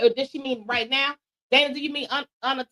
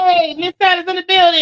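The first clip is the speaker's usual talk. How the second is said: excited shout-out; 4 semitones higher, 8 dB louder than usual. Real, not acted. Welcoming a guest on air.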